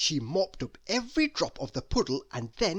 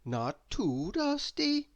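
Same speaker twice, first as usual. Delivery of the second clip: slow, higher, lightly voiced lady; murmured to herself, slowly and higher; 8 semitones higher, slower than usual. A narrator's reading. Miss Neat checking her cottage, slow and higher. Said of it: "she said to herself".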